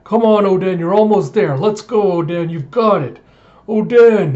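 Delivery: high, loud rallying shouts; root for him like a whole crew at the rail; cheering. Retold or imitated. Pirates urging on the man on the chain, cheering. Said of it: "pirates starting to cheer".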